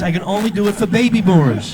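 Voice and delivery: normal talking voice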